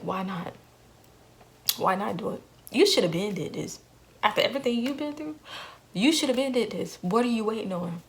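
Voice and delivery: a dark voice egging her on; tempting inner voice. Retold or imitated. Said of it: "something was like".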